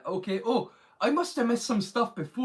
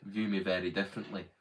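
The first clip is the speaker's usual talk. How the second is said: much lower, softer relaxed voice; relaxed natural low voice; 10 semitones lower, 6 dB quieter than usual. Shown as a demonstration. Showing viewers his real relaxed voice, much lower and softer. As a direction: let the voice sink to its natural low. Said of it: "I talk like this".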